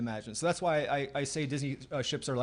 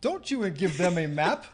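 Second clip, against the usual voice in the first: laughing tease; 9 semitones higher, 6 dB louder than usual. Real, not acted. Teasing co-host about video.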